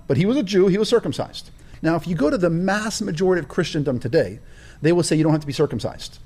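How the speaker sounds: ordinary talk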